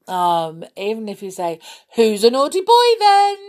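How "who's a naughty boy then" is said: put-on very high, loud baby-talk voice; funny voice. Shown as a demonstration. Teasing a baby to make it laugh, funny voice. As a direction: squeal it playfully at a baby. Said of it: "you're saying it in a funny voice".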